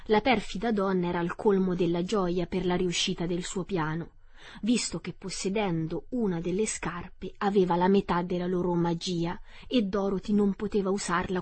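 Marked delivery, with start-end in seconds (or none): none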